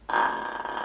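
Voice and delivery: low creaky fry on uh; vocal fry. Shown as a demonstration. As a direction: creak down at the bottom of the voice. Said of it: "it sounds a bit like this".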